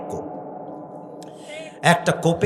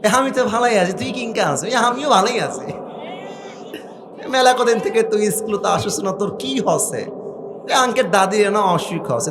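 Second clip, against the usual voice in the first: two schoolkids chatting in Naogaon dialect, higher voice; lightly acted Naogaon village kids; playful Naogaon accent; 6 semitones higher than usual. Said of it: "নওগাঁর ভাষাও তো আরো সুন্দর".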